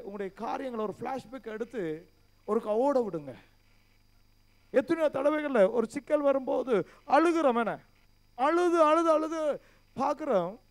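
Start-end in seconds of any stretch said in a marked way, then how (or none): 4.7-10.6 loud, raised preaching voice, pressing the questions; forceful preaching. Acted. Pastor challenging the brothers in church, loud forceful preaching. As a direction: hammer each question at the congregation.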